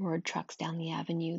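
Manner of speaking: ordinary delivery, nothing marked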